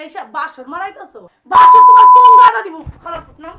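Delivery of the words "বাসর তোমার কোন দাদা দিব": screamed at full force, much higher and louder; furious shout. Acted. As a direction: explode at him in rage. Wife confronts cheating husband.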